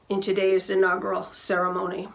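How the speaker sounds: ordinary talk, nothing marked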